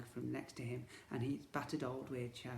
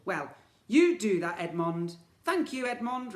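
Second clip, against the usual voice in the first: high, loud befuddled twin voice; muddled villain twin; 7 semitones higher, 11 dB louder than usual. Acted. Twins confusing each other's names, high loud muddled bickering.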